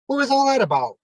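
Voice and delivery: incredulous promoter. Retold or imitated. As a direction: squeal out the boss's shock. Angry promoter confronts him.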